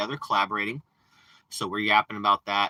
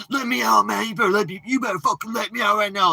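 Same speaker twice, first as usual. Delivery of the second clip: much higher, shrill mock panic; mocking drama; 13 semitones higher, 7 dB louder than usual. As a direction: ham up his whiny demand to be let out. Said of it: "All that drama, like".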